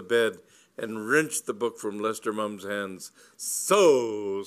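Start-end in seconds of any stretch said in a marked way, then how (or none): 3.4-4.4 loud, drawn-out stern "So"; triumphant accusation. A narrator's reading. Lady Bassett catching the book thief, loud and stern. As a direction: pounce on the culprit, loud.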